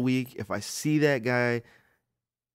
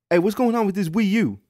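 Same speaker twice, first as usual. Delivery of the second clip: high, booming mock customer; loud obnoxious customer; 10 semitones higher, 7 dB louder than usual. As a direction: butt in loudly as an oblivious shopper. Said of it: "be that loud and obnoxious".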